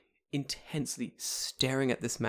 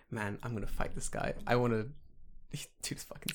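whispering; 5 dB quieter than usual. Real, not acted. Awed aside.

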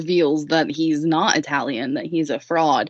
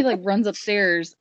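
ordinary talk, nothing marked

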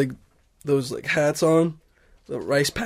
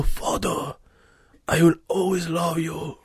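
dying farewell; 5 dB quieter than usual. Acted. Dying samurai son farewell.

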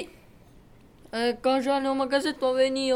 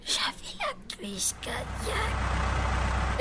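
slow, breathless whisper; 7 semitones higher, 8 dB quieter, slower than usual. Acted. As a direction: whisper it, still shaken. After the scare.

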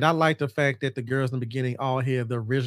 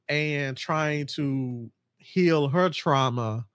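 ordinary delivery

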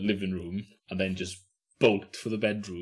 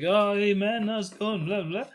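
higher, breezy imitation of Pablo; mock Pablo greeting; 12 semitones higher than usual. Friend imitates Pablo greeting him after years, higher voice. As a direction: play Pablo acting like nothing happened. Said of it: "He's like".

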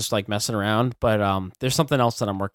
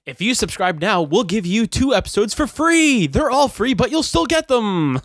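high, loud, hammed-up sales pitch; mock ad announcer; 11 semitones higher, 6 dB louder than usual. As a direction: holler the offer like an over-the-top TV pitchman. Joins in the mock advertising pitch.

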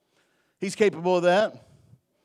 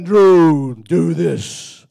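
much louder, a big booming call from above; booming voice from heaven; 13 dB louder, much slower than usual. Retold or imitated. Pastor imitating God's audible voice from heaven, booming. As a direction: boom it down like God from the sky. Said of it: "just barreling down from heaven".

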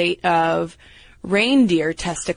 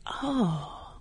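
much softer imitation of a nephew; small, deflated oh; 10 dB quieter than usual. Younger nephew getting his answer from big brother, quietly taken aback. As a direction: let out a soft little "oh". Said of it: "my younger nephew was like".

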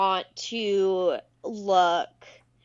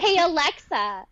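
high, raised call; 7 semitones higher, 3 dB louder than usual. Pretend. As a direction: call to the device. Calling out to smart speaker.

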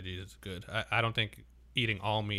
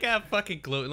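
high-pitched mocking imitation; mocking quote, pitched well up; making fun; 10 semitones higher, 4 dB louder than usual. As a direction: tease in a raised, mocking pitch. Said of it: "he started making fun of me".

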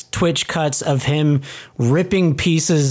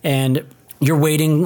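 ordinary talk

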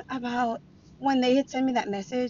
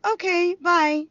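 higher, breezy sing-song brush-off; dismissive goodbye; 9 semitones higher, 4 dB louder, slower than usual. Retold or imitated. Brushing off her crying ex-husband, dismissive. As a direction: wave him off lightly. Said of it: "I was like".

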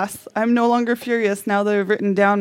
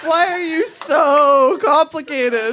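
slow, higher, louder exasperated complaint, half laughing; mock exasperation; 4 semitones higher, 3 dB louder, slower than usual. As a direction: drag out the whine at math. Exasperated letter to math.

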